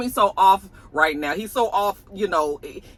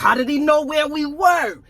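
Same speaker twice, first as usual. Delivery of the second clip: mock shout; 9 semitones higher, 4 dB louder than usual. Recapping a tense scene.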